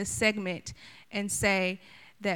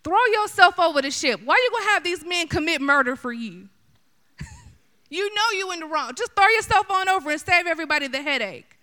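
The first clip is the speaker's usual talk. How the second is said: loud, high scolding; preacher scolding Jonah, loud and high; calling Jonah out; 7 semitones higher, 5 dB louder than usual. Acted. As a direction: chew him out for dragging others down. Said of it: "my question to Jonah would be".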